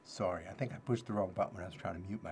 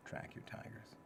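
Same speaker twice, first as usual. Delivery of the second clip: much softer repeat of the heading; soft echo; 13 dB quieter than usual. Shown as a demonstration. Repeating the tip for listeners, much softer. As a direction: let it fall away quietly. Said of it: "repeats heading softly".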